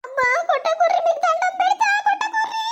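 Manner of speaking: high-pitched, desperate begging; frightened pleading. Acted. Wife begging husband to stop hitting her, frightened. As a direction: plead through pain for mercy.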